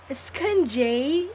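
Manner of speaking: put-on playful British-accent echo. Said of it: "Is there any English people".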